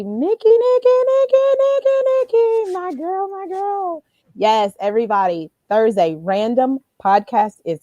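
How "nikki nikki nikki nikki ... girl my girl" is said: high, squealed sing-song calling of a name; gleeful high-pitched hello to a friend; excited singsong greeting. Real, not acted. Greeting co-host Nikki joining.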